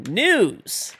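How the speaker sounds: "News!" said high and loud, correcting; corrected word. Real, not acted. Streamer correcting chat's misreading with a loud "News!". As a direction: snap "News!" sharply to fix the word.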